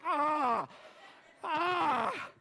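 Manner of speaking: anguished cry. Retold or imitated. Fan hearing his team lose, a retold pained cry. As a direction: cry out in agony.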